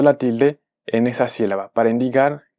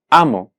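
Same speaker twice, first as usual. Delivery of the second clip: amo with a strong opening A, falling on mo; A-mo, first syllable strongest; stress on first syllable. Shown as a demonstration. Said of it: "sílaba tónica en la penúltima".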